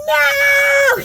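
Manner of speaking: high, loud stretched cry of "No!"; mock slow-motion no. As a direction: wail it like a cartoon in slow motion. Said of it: "that's how I was".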